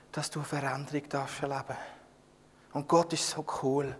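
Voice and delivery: deutlich leiser, nah und persönlich zum Zuhörer; eindringliche leise Predigt. Acted. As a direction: leg es dem Zuhörer sanft ans Herz. (leise, eindringliche Predigt an die Gemeinde)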